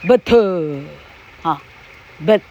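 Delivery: but as ba-te with an extra vowel at the end; but with a Taiwanese accent; Taiwanese-accented English. Shown as a demonstration. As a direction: say but the Taiwanese way, two beats. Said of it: "台客就念作".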